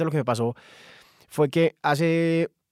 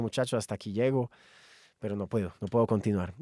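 quiet resigned goodbye; 3 semitones lower, 5 dB quieter than usual. Retold or imitated. Saying goodbye to friends.

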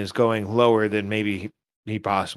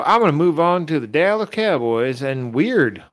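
put-on Texas accent; 3 semitones higher than usual. Podcaster announcing his Cowboys pick, hammed-up Texas accent. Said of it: "Does it sound like a Texas accent".

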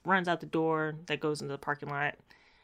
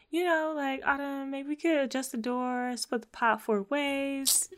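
higher, soft and hesitant, slowing down at the end; meek suggestion in a raised voice; timid Keyshawn; 8 semitones higher, 3 dB quieter than usual. Retold or imitated. Podcaster voices shy Keyshawn asking to split the pot.

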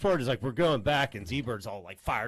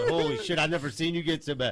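usual speaking voice

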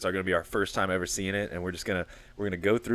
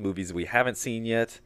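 ordinary talk, nothing marked

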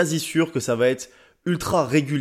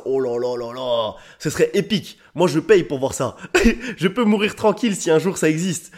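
real excitement, raised pitch with laughter; hyped-up, awestruck enthusiasm; getting carried away; 3 semitones higher than usual. Real, not acted. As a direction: gush over an epic vision. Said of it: "je m'emballe trop".

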